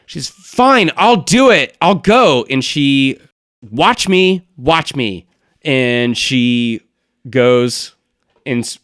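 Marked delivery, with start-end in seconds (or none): 0.3-2.4 loud, high, huffy outburst; high-pitched exasperated declaration; exasperated giving-in. Acted. Acted character gives in, exasperated. 3.7-5.2 high, loud defiant dare; loud huffy challenge. Acted. Same character defiantly sets off.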